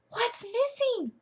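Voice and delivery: very high, drawn-out astonished question; exaggerated high-pitched teacher wonder; playful mock surprise. Shown as a demonstration. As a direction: gasp and wonder aloud for a child. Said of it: "you'll say".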